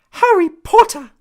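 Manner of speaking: an excited little elf calling out the name; high-pitched elf voice. A narrator's reading. House elf meeting Harry, high squeaky thrilled cry. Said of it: "in a high-pitched voice".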